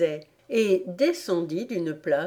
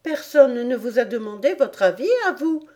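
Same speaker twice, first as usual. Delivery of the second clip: higher, louder snapping Alice; sharp retort; 6 semitones higher, 5 dB louder, faster than usual. A narrator's reading. Alice snapping at the Hatter, sharp and annoyed. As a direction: snap back at him. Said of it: "répliqua Alice".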